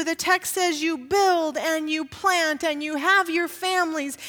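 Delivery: full-voiced pulpit exhortation; preaching. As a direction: drive the sermon home to the congregation.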